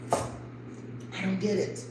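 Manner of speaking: breathless, near-crying whisper of "I don't get it"; tearful whisper. Real, not acted. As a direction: whisper "I don't get it" slowly, choking up, real tears close. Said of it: "Sometimes I cry".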